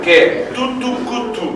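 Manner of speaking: "tu, tu, ku" in a rapid triple tonguing pattern; tongue "tu, tu, ku" as quick detached syllables; triple staccato. Shown as a demonstration. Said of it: "I can play triple staccato".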